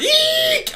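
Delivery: sudden very high squeak; high comic squeal for German; shrill mock squeal. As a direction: squeal it like a terrified yelp. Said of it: "he's like".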